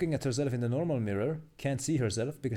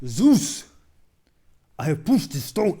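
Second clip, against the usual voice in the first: first try at a big mythic shout, much louder and higher; Sisyphus voice; 6 semitones higher, 11 dB louder, slower than usual. Acted. Streamer reading the meme to Zeus, loud mythic shout. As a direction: belt it out to the god, booming.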